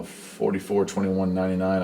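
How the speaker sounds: ordinary talk, nothing marked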